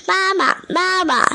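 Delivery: high-pitched tadpoles' call; little tadpoles hailing their mother; calling for mama. A narrator's reading. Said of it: "就迎上前去叫".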